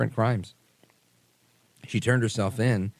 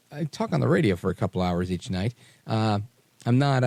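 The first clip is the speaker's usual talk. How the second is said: ordinary talk, nothing marked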